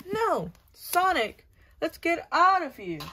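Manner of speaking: high, loud cry for help; panicked shout. Pretend. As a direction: yell to Sonic to flee. Character in panic calls out.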